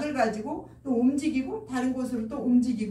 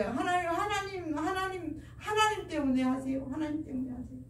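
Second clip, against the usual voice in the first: high-pitched imploring prayer, trailing off; earnest prayer; 5 semitones higher than usual. Acted. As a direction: beg God earnestly, calling over and over. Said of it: "막 간절하게 기도할 때".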